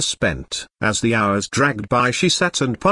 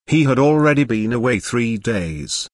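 ordinary delivery

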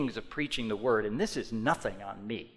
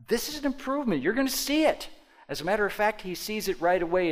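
louder, much higher proclamation; rising preacher's cry; fervent preaching; 8 semitones higher, 6 dB louder, faster than usual. Acted. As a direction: lift it to a triumphant peak. Preaching climax.

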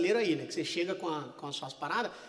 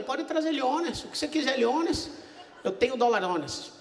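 slow, hammed-up fake Spanish accent; mock Spanish; 5 semitones higher, slower than usual. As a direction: stretch the words like a cocky tourist in fake Spanish. Answering waitress in fake Spanish.

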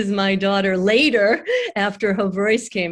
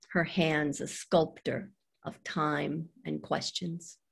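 poem reading; 2 semitones lower, 6 dB quieter than usual. Acted. Mother closing a poem about her daughter, quiet measured recital. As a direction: settle into quieter lines with pauses.

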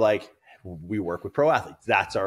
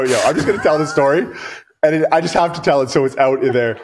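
ordinary talk, nothing marked

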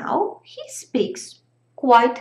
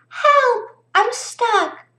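crying for help; 11 semitones higher, slower than usual. Acted. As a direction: call out desperately, stuck in a tree. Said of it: "the fairy cried".

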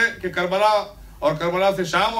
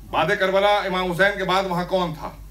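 ordinary talk

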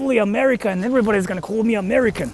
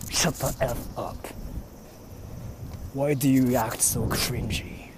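impression of Swift; 6 semitones lower, 7 dB quieter than usual. Streamer mimicking a viewer scolding him, low and quiet. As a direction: mutter it low and dismissive, like a bored friend. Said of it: "I'm gonna just try to be you".

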